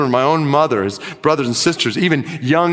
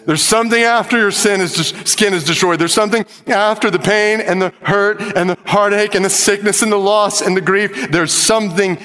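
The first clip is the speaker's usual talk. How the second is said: higher, fast climactic preaching; preaching at full force; 7 semitones higher than usual. Real, not acted. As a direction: drive it home to the congregation. Climactic preaching, congregation responds.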